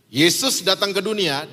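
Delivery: usual speaking voice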